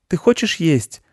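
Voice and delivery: put-on high boy's question; high-pitched young boy asking gently; little boy. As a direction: ask in a high child voice.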